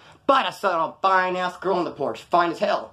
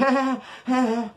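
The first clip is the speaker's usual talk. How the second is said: nervous laugh; 8 semitones higher than usual. Real, not acted. Nervous laugh after noogie.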